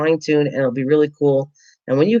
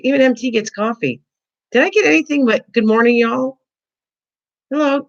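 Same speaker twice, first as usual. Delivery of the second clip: high, pouting mock outrage; mock indignant; 7 semitones higher than usual. Host teasing viewers who greeted others, pouting mock outrage. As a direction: whine playfully about being left out.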